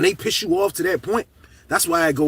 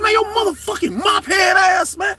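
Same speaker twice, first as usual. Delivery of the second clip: retold furious yelling; 3 dB louder than usual. Inmate yelling at the officer who opened his door. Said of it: "I snap".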